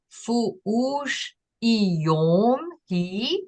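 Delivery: "fu ush i yom hi" spoken very slowly, rising on "i"; slow Aui phrase. Shown as a demonstration. Teacher slowly modelling an Aui phrase for the learner. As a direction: spell out "fu ush i yom hi" syllable by syllable. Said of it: "So I am".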